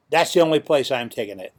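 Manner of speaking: ordinary delivery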